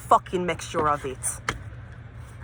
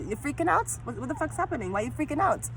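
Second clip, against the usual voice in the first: high startled squirrel taunt; 8 semitones higher, 6 dB quieter than usual. Real, not acted. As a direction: pitch jumps high, incredulous. Yelling at squirrel, high pitch.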